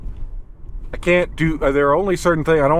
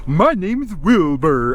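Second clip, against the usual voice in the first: slow, grand, higher imitation of a majestic steed; mock majestic voice; 6 semitones higher, 3 dB louder, slower than usual. Imitating a game master's majestic horse character, slow and grand. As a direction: ham up a noble horse character. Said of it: "that's how he talked the whole time".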